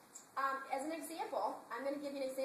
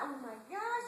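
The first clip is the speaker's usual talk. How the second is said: usual speaking voice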